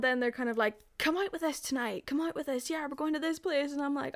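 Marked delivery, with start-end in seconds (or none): 1.0-3.7 eager invitation. Retold or imitated. Imitating friends urging her along.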